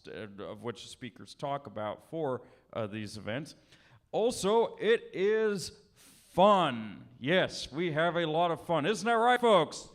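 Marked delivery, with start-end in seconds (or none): 4.1-9.9 loud, high crowd hype; hyping the crowd. Real, not acted. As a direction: rev up the audience. Host pumping up the audience.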